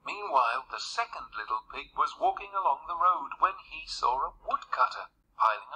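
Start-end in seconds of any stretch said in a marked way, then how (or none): none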